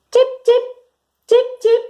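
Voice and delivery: very high, short chirps; bird chirps. Shown as a demonstration. Teacher giving kids a clue for the bird, chirping. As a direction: chirp in high little peeps. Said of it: "The bird says tip".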